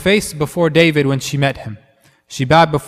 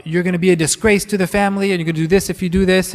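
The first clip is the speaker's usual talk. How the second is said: higher, fast nagging rebuke; mock scolding; 4 semitones higher, faster than usual. Relative warning of disgrace to the family, mock scolding. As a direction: rattle off a higher-pitched telling-off.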